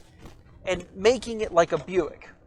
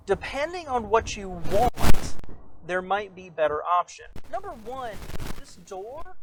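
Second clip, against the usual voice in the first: normal talking voice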